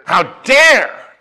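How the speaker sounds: high, loud indignant cry; acted righteous outrage. Preacher re-enacting his offended pastor, outraged. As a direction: hurl it out, appalled.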